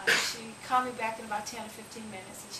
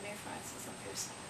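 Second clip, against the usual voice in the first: low, hushed and sorrowful; sadly sorry; 13 semitones lower, 9 dB quieter than usual. Retold or imitated. Store owner sadly turning Mary away, low and hushed. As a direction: break the bad news gently, almost under the breath. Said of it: "and he sadly told me".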